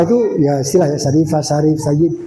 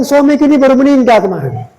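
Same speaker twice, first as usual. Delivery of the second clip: raised, louder plea of a starving widow; pleading widow; 4 semitones higher, 4 dB louder than usual. Acted. Widow pleads hunger to the scholar, raised earnest plea. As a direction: beg him to understand, voice lifted. Widow explains why she eats carrion.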